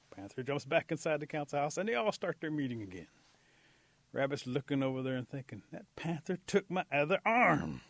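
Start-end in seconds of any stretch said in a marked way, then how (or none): 5.7-7.8 slow, louder, mildly outraged complaint; indignant Rabbit. A narrator's reading. Rabbit looks toward council house, mildly indignant complaint. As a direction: drag out the grievance a little.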